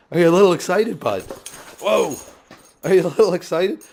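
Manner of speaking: high, loud talk to the puppy; high dog-talk voice. Real, not acted. Talking to the excited puppy.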